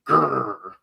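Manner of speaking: imitated snarling growl; short put-on beast growl; mock growl. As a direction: growl it out as a caricature. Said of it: "The real face of A O C".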